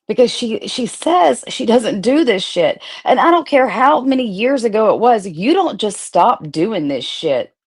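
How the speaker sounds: ordinary talk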